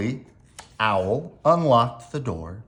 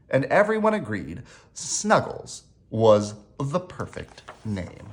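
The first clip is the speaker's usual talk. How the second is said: ordinary delivery, nothing marked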